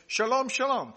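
"Shalom, shalom" said high and loud; emphatic doubled word. Shown as a demonstration. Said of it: "That is an emphasis on the word peace".

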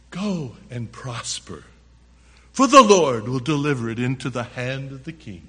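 sarcastic prophet parroting good news. Acted. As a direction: say it as hollow, sarcastic encouragement. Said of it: "Very sarcastically, probably".

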